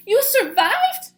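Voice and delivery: high, loud, slow joyful disbelief; excited cry. Acted. Stellaluna amazed mother lived, high loud cry. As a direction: marvel at it aloud. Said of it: "cried Stellaluna".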